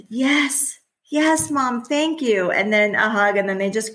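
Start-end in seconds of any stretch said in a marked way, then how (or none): 0.1-2.5 put-on child's voice. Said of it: "for your child".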